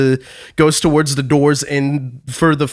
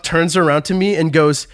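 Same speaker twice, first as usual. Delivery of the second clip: ordinary delivery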